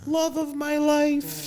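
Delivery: high, slow, sugary mock voice; mock the gushing caption. Man mocking his ex-girlfriend's post in a sweet voice. Quoting ex's Facebook caption.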